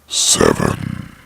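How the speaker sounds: very low, drawn-out monstrous announcer; spooky countdown voice. Acted. Narrator announces the next creepy story, deep spooky countdown. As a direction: drop deep and stretch the number out.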